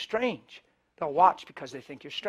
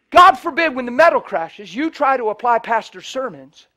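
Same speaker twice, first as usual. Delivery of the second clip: loud preaching; 6 semitones higher, 12 dB louder than usual. Real, not acted. Preaching about crash, loud.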